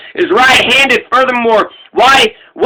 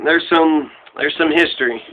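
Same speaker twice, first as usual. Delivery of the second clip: low, soft, subdued wrap-up; quiet aside; 7 semitones lower, 5 dB quieter than usual. Real, not acted. Podcaster winding up his history recap, quiet aside. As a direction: let it drop low and soft. Trails off, then restates it.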